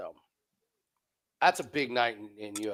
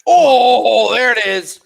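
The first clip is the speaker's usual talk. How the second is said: excited shout; 7 semitones higher, 15 dB louder, much slower than usual. Real, not acted. Excited call of a punch.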